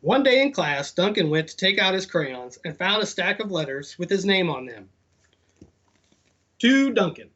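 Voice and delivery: lively storybook read-aloud; animated picture-book narration; reading to children. Acted. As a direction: read it to the kids with bounce. Reads storybook to kids.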